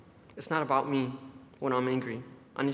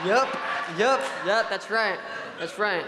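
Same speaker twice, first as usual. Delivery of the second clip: eager agreement; 4 semitones higher than usual. Real, not acted. Crowd reaction, agreeing.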